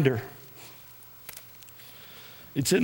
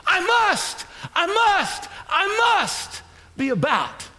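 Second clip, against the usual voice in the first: high, emphatic repeated declarations; fervent preaching refrain; 12 semitones higher, slower than usual. Real, not acted. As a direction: punch each phrase like a rallying cry. Rallying refrain to congregation.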